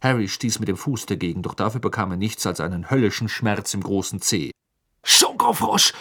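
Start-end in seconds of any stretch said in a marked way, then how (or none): 5.0-5.9 high, loud burst of temper; angry shout. A narrator's reading. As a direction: yell it in a rage. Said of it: "schrie er zornig auf einem Bein hüpfend".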